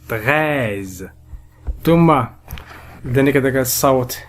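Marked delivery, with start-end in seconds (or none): 0.1-1.1 "Treize" lower, with a long open e; drawn-out vowel. Shown as a demonstration. Teacher presenting the first sound, "Treize" with its vowel drawn out. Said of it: "إذن الصوت الأول nous avons".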